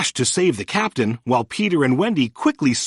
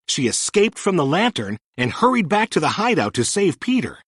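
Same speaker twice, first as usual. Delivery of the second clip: ordinary delivery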